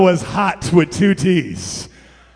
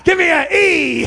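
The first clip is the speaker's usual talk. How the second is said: higher-pitched pep-rally call; mock cheerleading; 9 semitones higher, 6 dB louder than usual. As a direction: call out the next letter to the crowd. Disciples as cheerleaders.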